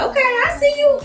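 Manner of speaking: squeaky high mock approval; sarcastic high-pitched approval of his bragging. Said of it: "I was like".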